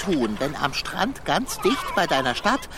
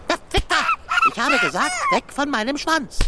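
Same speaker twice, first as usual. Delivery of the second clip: sharp, much higher scolding; angry shooing; 12 semitones higher, much faster than usual. Acted. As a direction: shoo them off your tail. Verscheucht Mini-Menschen. (wütendes Verscheuchen)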